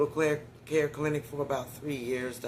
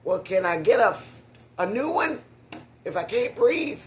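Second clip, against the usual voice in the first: high, loud protest, rising at the end; exasperated plea; 9 semitones higher, 8 dB louder than usual. Retold or imitated. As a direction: re-enact an indignant plea to a doctor. Acts out pleading with the doctor.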